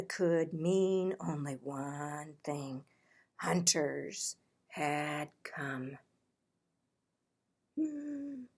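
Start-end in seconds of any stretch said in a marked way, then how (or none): none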